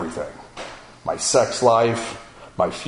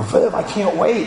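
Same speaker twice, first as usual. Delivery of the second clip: higher, slower, pleading; urgent plea; 9 semitones higher than usual. Retold or imitated. Urging his pastor to meet now, high and drawn out. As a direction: stretch it out, desperate to be heard.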